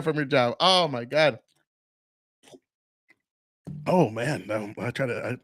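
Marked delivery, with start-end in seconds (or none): 3.9-5.4 choking on a cough. Real, not acted. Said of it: "I almost coughed and it went in".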